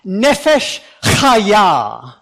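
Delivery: Nefesh chaya slow, loud, every consonant exaggerated; over enunciating. Shown as a demonstration. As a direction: spit out Nefesh chaya slowly. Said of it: "as dramatically as you possibly can".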